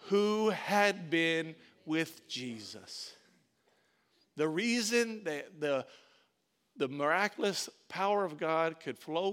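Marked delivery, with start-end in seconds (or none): 0.1-3.2 very slow, each word drawn out; slow call-and-response line; said together. Shown as a demonstration. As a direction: lead the congregation word by word. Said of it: "say it with me".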